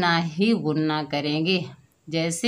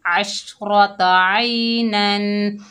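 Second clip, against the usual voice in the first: slow recitation with nasal ghunna; 2 semitones higher, 4 dB louder, much slower than usual. Shown as a demonstration. Teacher demonstrating ghunna in tanween, slow chanted recitation. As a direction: chant it slowly, humming the final nun. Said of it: "गुन्ना किया".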